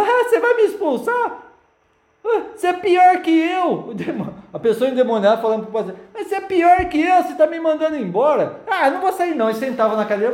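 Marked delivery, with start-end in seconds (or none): 0.0-3.9 high, slow, taunting demon; mocking demon voice. 6.1-9.5 high, teasing demon voice; mock demon taunt.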